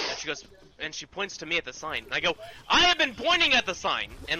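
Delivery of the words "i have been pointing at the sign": high, loud indignant retort; retold outburst at a useless coworker; exasperated yell. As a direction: yell it like you have lost all patience. Retelling her angry outburst at Betty.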